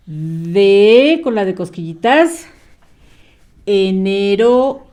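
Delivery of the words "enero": "Enero" said slowly, each syllable clear; slow dictation. Shown as a demonstration. Teacher dictates "Enero" slowly to a young pupil. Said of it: "una palabrita muy cortita".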